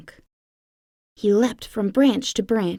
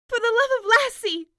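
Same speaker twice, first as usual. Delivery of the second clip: much higher, alarmed outburst; barked exclamation. A narrator's reading. Mother dog seeing her son stuck, alarmed much higher cry. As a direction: yelp it out in alarm. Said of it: "his mom barked".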